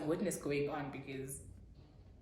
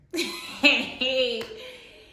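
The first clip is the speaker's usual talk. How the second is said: loud laugh; 7 semitones higher, 8 dB louder than usual. Real, not acted. Laugh before "it was the worst.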